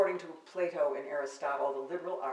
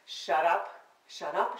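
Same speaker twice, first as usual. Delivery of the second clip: sharp, louder snapping shut up; mock angry shut up; 3 semitones higher, 7 dB louder, slower than usual. Poet telling off the man in her poem, sharp and loud. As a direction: snap it at him like telling off a lover.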